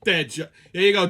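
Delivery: normal talking voice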